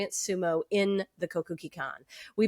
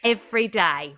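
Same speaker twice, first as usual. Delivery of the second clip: terrible put-on British accent; amateur Swindon accent. Host reading winner's letter, bad British accent. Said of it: "I'm gonna stop the terrible accent now".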